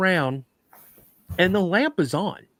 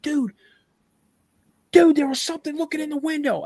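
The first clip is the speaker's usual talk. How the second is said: high, fast, re-enacted panic; acted-out freak-out, pitch way up; panicked report; 11 semitones higher, faster than usual. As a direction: blurt it out in a high, frightened voice. Acting out his panic to friend.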